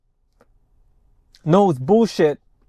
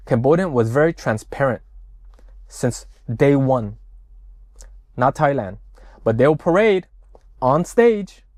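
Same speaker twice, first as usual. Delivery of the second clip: ordinary talk, nothing marked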